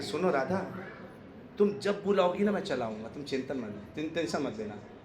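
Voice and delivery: low, hushed reassurance; confiding in her ear. Retold or imitated. Krishna promising Radha privately before leaving, confiding in her ear. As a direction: drop to a soft, private undertone, imitating a secret promise. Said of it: "कान में बोल के गए थे".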